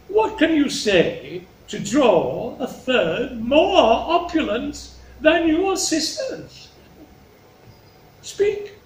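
high, slow, grand royal question; expectant king. Acted. King asks Cordelia to outdo her sisters, grand and slow. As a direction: coax grandly, rising to a high prompt.

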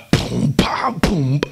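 mouthed thumping party beat; imitated music beat. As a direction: boom out the bass with your mouth. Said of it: "שומע המוזיקה". (חיקוי בפה של בומים של מוזיקה)

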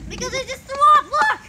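high, loud exclaiming; loud shout. Real, not acted. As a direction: yell it, grossed out. Just threw up.

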